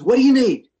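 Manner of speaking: high, loud, curt demand; curt minister. Retold or imitated. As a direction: bark the question at the young man. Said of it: "He goes".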